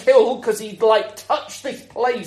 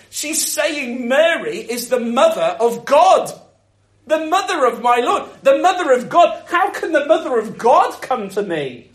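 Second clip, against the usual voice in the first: ordinary talk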